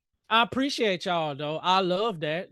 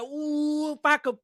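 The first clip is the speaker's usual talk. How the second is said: a touch higher, half-joking karate threat; lightly voiced mock anger; mock mad threat; 5 semitones higher than usual. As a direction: playfully threaten like you're mad. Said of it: "I'm like".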